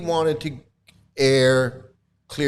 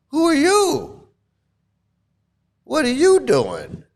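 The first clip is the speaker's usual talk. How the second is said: indignant guru; 7 semitones higher than usual. Retold or imitated. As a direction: rant back in huffy offended disbelief. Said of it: "And Prabhupada's like".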